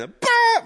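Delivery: a sharp, very high, loud "bah!"; sudden trumpet blast. Pretend. Trumpet voice behind John.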